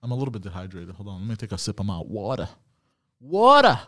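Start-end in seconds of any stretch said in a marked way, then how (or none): 3.2-3.8 calling out. Real, not acted. Calls out for water.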